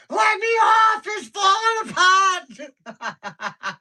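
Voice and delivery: full-volume, very high shriek; imitated screaming. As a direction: scream like a terrified child on a ride, drawing out the end. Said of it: "She was screaming".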